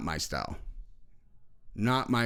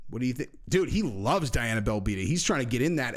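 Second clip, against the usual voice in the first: ordinary delivery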